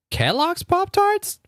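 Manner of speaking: high, slow disbelieving squeak; can't believe what she's reading; incredulous. Real, not acted. Reading a search result in stunned high-pitched disbelief.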